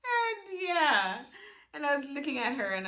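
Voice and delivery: choked up, holding back tears; a teary trailing yeah; tearful. Real, not acted. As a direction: voice breaking with emotion. Emotional moment.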